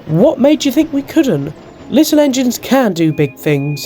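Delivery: loud, high confident little engine; triumphant Thomas. A narrator's reading. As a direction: crow it proudly and loudly. Thomas cheers on Percy in triumph.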